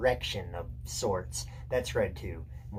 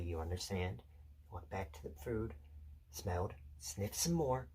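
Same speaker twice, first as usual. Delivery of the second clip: hushed suspense narration; 2 semitones lower, 5 dB quieter than usual. Acted. Telling Peter how the dog crept to the meat, hushed suspense. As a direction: creep through it quietly, pausing between moves.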